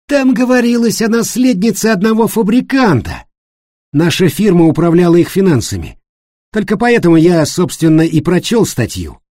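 high, loud, emphatic storytelling in character; animated accuser David. Acted. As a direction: pitch it up and lay out the facts forcefully. Voices David telling the story. (высокий, громкий голос увлечённого рассказчика-обвинителя)

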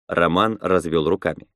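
ordinary delivery, nothing marked

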